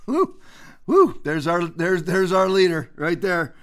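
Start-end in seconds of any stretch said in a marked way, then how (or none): 0.1-1.2 high mock whoops; mock cheer. Host mocking a crowd for the president, sarcastic whooping.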